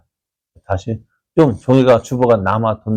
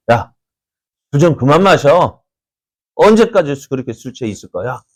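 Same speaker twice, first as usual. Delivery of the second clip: sharp, raised scolding voice, higher and louder; scolding priest; 4 semitones higher, 4 dB louder than usual. Retold or imitated. Priest Eli scolding Hannah for being drunk, raised scold. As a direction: snap at her to stop drinking.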